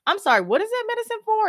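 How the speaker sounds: very high, pointed questioning; incredulous. Retold or imitated. Family questioning doctors about a medicine, incredulous. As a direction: feign politeness in high-pitched disbelief.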